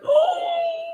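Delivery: high excited whoop; excited outburst. Retold or imitated. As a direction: let out a high-pitched thrilled cry. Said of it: "so I'm like".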